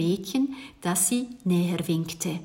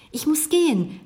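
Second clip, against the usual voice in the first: ordinary delivery, nothing marked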